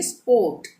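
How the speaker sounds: "स्पोर्ट" as "spawt", no r; silent r. Shown as a demonstration. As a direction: leave the r out of "स्पोर्ट". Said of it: "इसको हम पढ़ेंगे". ("स्पोर्ट" में र silent)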